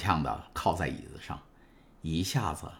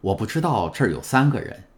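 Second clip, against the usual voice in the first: frowning; 4 semitones higher, 5 dB louder than usual. A narrator's reading. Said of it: "唐泰斯皱着眉头转过身来说".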